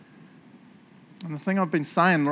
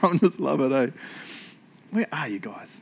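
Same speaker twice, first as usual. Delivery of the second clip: normal talking voice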